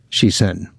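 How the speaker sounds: ordinary delivery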